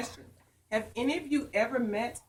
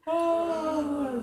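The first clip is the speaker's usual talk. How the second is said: long drawn, higher yawning "oh"; pretend yawn; 6 semitones higher, 4 dB louder than usual. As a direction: fake a big yawn for the children. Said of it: "We got a yawn".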